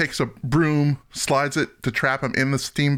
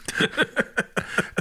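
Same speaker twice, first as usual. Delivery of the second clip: high breathy laugh; 11 semitones higher, 4 dB quieter than usual. Retold or imitated. Electrocuted sound retold.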